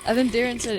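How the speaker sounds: ordinary talk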